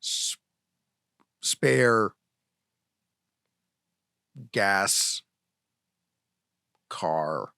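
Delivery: halting single-syllable words with long pauses; Neanderthal talk. Shown as a demonstration. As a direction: talk like a caveman, one word at a time. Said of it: "like a Neanderthal would talk".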